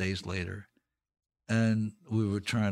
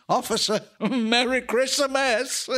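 laughing quote; 12 semitones higher, 4 dB louder, slower than usual. Retold or imitated.

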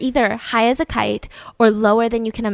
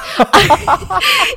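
bright laugh; 8 semitones higher, 5 dB louder than usual. Real, not acted. Host laughs at herself.

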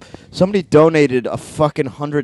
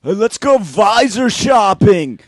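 slow, loud, higher bro shout; frat bro voice; 6 semitones higher, 7 dB louder, much slower than usual. Acted.

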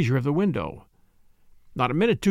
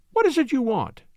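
higher-pitched, tense question; urgent demand; 8 semitones higher than usual. Acted. Cornered woman turning to the detective, urgent demand.

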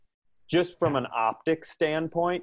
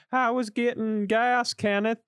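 high, prissy wife imitation; 9 semitones higher than usual. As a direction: squeak it like the wife. Wife voiced in a bit.

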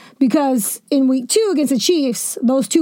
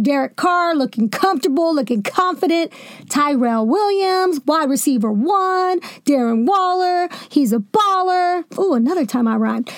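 ordinary talk, nothing marked

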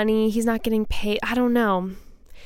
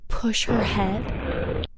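ghost voice; 3 dB quieter, much slower than usual. Retold or imitated. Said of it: "I'm hearing, like".

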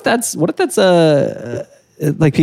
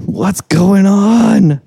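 tripping-out animal voice; 6 semitones higher, 4 dB louder, much slower than usual. Retold or imitated. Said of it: "They're just like".

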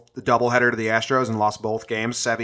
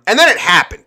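ordinary talk, nothing marked

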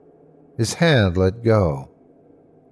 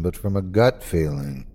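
plain reading voice

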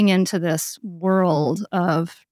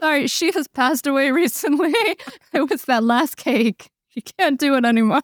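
higher-pitched, joking excuse through laughter; laughing mock apology; 5 semitones higher than usual. Interviewer pretends to report the guest's death, laughing. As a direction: play a fake bereavement call, giggling. Jokes as husband phoning, laughing.

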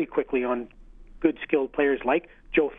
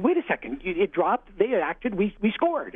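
higher, pitched-up protest at the ref; retold protest to the referee, pitched up; heated protest; 7 semitones higher, slower than usual. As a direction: plead your case, voice rising.

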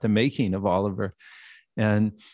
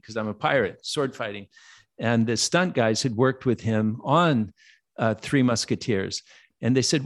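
ordinary delivery

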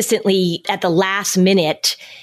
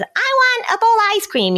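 put-on high, whiny little kid demanding ice cream; whiny child voice. Parent imitating a child demanding ice cream, high and whiny. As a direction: pitch way up like a pleading child. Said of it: "your child says".

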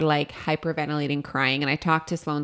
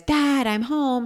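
put-on high, slow sing-song call of a kid; child calling out; 9 semitones higher, much slower than usual. Mom imitating son calling home. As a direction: call out like the little boy arriving home.

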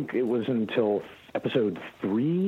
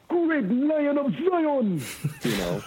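Hermes catchphrases. Shown as a demonstration. Showing the hosts Hermes' catchphrases in a thick Jamaican accent. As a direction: belt out Hermes' sayings, laughing at the end. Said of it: "the accent got a little thicker".

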